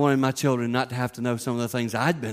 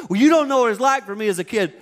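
put-on lecturing kids; 6 semitones higher, 5 dB louder than usual. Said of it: "Sometimes I say to my children".

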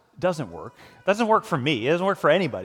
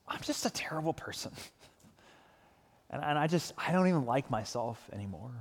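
despairing; 7 dB quieter than usual. Retold or imitated. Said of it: "leads to despair".

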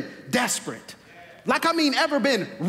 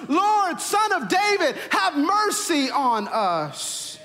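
desperate cry out; 2 semitones higher, much slower than usual. Acted. Blind beggars calling to Jesus as he passes, crying out desperately. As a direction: cry out for mercy, stretching the last words long. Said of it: "they cried out with all of their voice".

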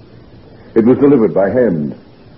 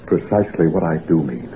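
grim quiet admission; 5 semitones lower, 6 dB quieter than usual. Acted. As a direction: lower and softer, heavy. Patient admits fearing madness.

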